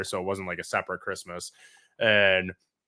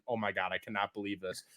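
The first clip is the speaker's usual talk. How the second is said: low, soft disbelief; mock sulking; 5 semitones lower, 13 dB quieter than usual. Kid gone to the room over apple pie, quiet sulking. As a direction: say it under your breath, upset.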